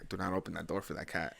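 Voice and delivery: sleepy warning. Retold or imitated. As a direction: growl it from bed. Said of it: "I wake up and I'm just like".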